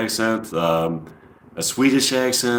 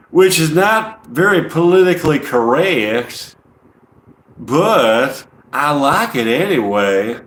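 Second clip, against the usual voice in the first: slow, higher-pitched Southern drawl; goofy Southern accent; 5 semitones higher, 3 dB louder, slower than usual. Acted. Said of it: "more fun to talk in my goofy voice".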